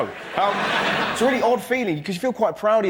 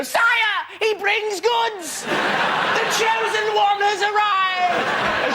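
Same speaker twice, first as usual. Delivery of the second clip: very high, very slow proclamation, ending in a shout; mock royal herald; 12 semitones higher, much slower than usual. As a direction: announce the donor like a herald at court. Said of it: "wants her to go".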